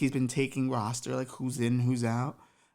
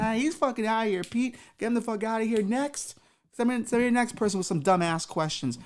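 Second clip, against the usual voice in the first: higher, loud dismissal; Jesus ordering Peter to remove him; fed-up Jesus; 8 semitones higher, 3 dB louder than usual. Acted. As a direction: throw him out, exasperated.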